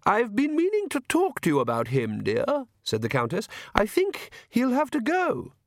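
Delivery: high-voiced Countess broaching a complaint; Countess, genteel and insistent. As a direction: raise the pitch for a decided wife. Narrator plays the Countess.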